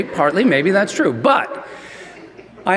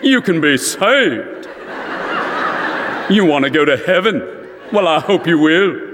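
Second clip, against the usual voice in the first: Billy Graham. Retold or imitated. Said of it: "that North Carolina accent".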